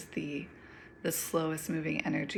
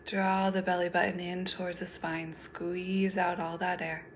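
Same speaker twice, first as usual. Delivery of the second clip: guided meditation. Acted. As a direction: lead them gently through each breath, unhurried.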